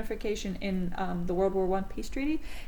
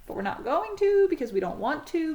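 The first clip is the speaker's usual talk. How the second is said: much higher, hammed-up evasive voice for the timid allies; high mock dodge; 11 semitones higher, slower than usual. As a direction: play the allies squeaking out a cowardly excuse. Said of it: "Britain and France is like".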